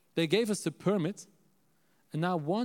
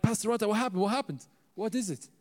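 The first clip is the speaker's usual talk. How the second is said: higher, urgent retold questions; alarmed questioning; 7 semitones higher, faster than usual. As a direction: press for news, acting worried. Reacting to bad news by phone.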